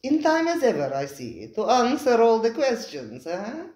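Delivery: lightly voiced, suave host; smooth restaurateur Spiro. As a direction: slide in smoothly, amused.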